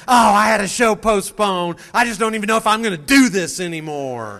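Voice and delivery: mock whine. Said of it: "You're going".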